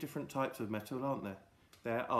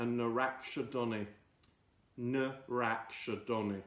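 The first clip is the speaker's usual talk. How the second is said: slow drawn-out word; 3 semitones lower, much slower than usual. Shown as a demonstration. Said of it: "repeating word slowly for kids".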